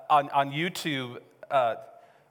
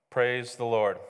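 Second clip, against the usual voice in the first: low, droning scripture recital; monotone Bible reading; 7 semitones lower, 4 dB quieter than usual. Shown as a demonstration. Preacher showing church how people read the Bible, monotone. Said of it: "our voices turn monotone".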